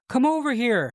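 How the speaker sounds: high-pitched mock call across a field; acted beckoning call; imitated calling out. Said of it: "A German voice calls out".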